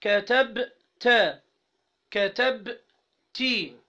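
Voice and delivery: very slow, drawn-out كتبتي, every syllable stretched; slow demo. Shown as a demonstration. Teacher slowly modelling كتبتي for the class. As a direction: dwell on each syllable of كتبتي. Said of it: "تقول كتبت".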